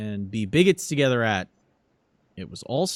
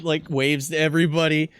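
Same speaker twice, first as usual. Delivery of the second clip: usual speaking voice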